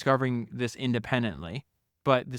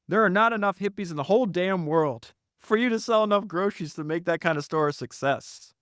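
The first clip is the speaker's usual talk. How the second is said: higher-pitched acted dismissal; dismissive interruption; 6 semitones higher than usual. Ben interrupting John's pitch, mock dismissive. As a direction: cut in and set the youngster straight. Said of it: "he interrupted me".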